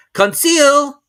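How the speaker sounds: calling out. A narrator's reading. Calling a third time.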